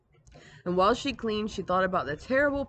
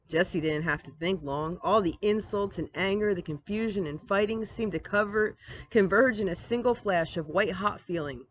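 ordinary delivery

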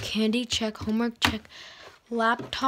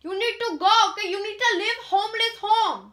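put-on high, fast scolding Indian-accented mother; amateur Indian accent; 7 semitones higher, faster than usual. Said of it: "my Indian accent is getting a".

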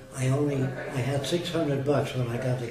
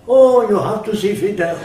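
much higher, louder eager cry; excited; 8 semitones higher, 12 dB louder than usual. Retold or imitated. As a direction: exclaim it with delight. Cubans urging again, acted quote.

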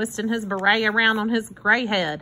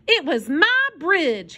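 slow, higher-pitched, stubborn insistence; indignant muttering aloud; 7 semitones higher, slower than usual. A narrator's reading. Hobo talking to himself about his bridge, indignantly. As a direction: lean hard and slowly on your claim. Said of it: "he said aloud".

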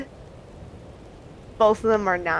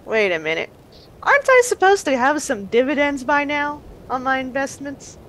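dawning realization; 5 semitones higher than usual. Acted. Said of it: "She's like".

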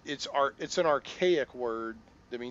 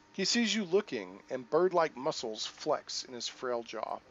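steady storyteller read; game narration; 4 dB louder than usual. Acted. Player reading narration to viewers, storyteller voice. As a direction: narrate the scene for the viewers, evenly.

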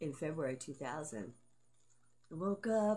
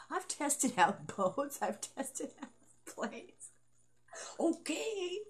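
laughing; 8 semitones higher, much slower than usual. Real, not acted. Joking boast while laughing.

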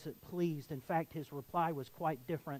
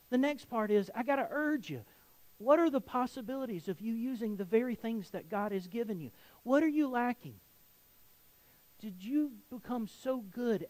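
higher, louder fired-up sermon; preaching at full force; 5 semitones higher, 6 dB louder than usual. Acted. Pastor urging the church to use their gifts, in a loud, fired-up voice. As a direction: push them hard, building urgency.

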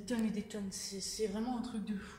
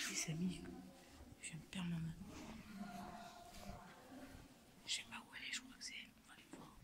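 whispering; 4 semitones lower, 4 dB quieter than usual. Real, not acted.

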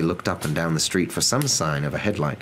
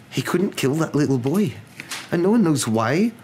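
high, trembling professor's voice; 9 semitones higher than usual. A narrator's reading. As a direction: tell grave news with a tremble, pitched high. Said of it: "Professor McGonagall's voice trembled".

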